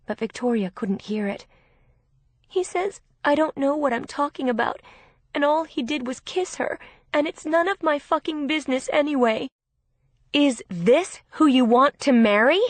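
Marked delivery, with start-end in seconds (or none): 2.5-9.5 high, upset and crying; tearful. A narrator's reading. Crying sister repeating fiancé. 10.3-12.6 loud, high furious shout. A narrator's reading. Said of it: "Victoria was shouting".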